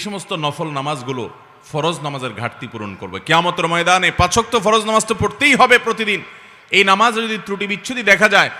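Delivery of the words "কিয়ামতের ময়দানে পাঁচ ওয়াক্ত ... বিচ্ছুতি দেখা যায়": high, loud sermon warning; forceful preaching. Acted. Preacher warning worshippers about Judgement Day, forceful preaching. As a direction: thunder it out to the congregation.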